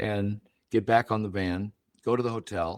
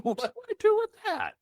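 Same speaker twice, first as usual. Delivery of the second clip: very high, strained mock-panic squeal; panicked squeak; faster than usual. New dad panics at the translator's warning, high squeaky voice.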